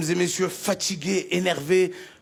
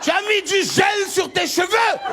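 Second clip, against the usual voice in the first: fiery imam; 9 semitones higher than usual. Retold or imitated. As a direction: thunder damnation from the pulpit. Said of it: "le imam".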